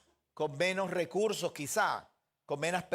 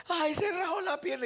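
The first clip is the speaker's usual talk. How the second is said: much higher, hammed-up alarm; mock panicked parent; 13 semitones higher, 4 dB louder than usual. Pastor mocking parents panicking over a scraped leg, high mock alarm. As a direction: squeal it like a parent overreacting.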